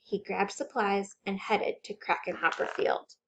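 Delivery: plain reading voice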